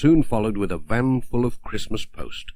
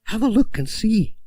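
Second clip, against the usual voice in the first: much higher, playful postmistress voice; Mrs Goggins; 12 semitones higher than usual. Acted. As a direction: coax him to go look, high and merry. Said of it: "said Missus Goggins".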